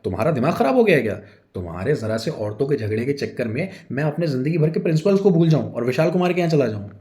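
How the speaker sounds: loud, fast indignant retort; indignant outburst. A narrator's reading. Husband refusing wife's demand, fast and indignant. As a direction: fire back in disbelief.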